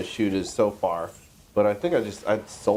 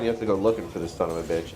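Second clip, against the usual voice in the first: ordinary talk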